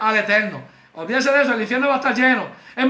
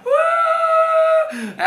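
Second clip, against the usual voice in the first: very high, sustained "woo"; long, piercing holler of celebration; celebrating whoop. Shown as a demonstration. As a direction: whoop like a fan at a game. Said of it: "¿cuántos de ustedes gritan?".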